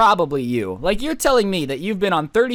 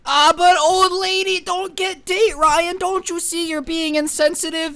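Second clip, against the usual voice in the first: high, slow, whiny mocking voice of the teacher; mocking the teacher in a high nasal whine; 12 semitones higher, slower than usual. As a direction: sneer it out as the whiny old lady.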